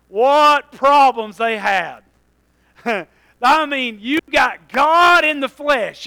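loud, high preacher's exclamation; full-force preaching. Real, not acted. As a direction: build the list like a fired-up preacher.

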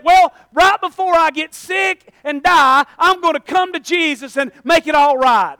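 a hint of a smug excuse-maker; mocking a procrastinator. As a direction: mimic someone putting off salvation. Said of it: "You don't get to set".

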